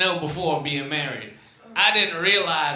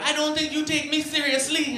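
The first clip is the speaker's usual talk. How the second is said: much higher mock-upset wife voice; imitating wife; 11 semitones higher than usual. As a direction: play the wife freaking out. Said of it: "she'll freak out".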